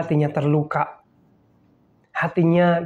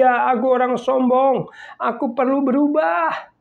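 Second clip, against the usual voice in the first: higher, lightly acted admission; humble confession; 8 semitones higher than usual. Pastor voicing a humble believer, light confession. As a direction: admit it humbly, a bit raised. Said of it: "dengan rendah hati mengakui".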